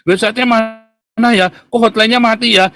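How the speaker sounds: a lightly voiced pestering client; mock nagging questions. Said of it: "Saya ditanya sama Pak Suara".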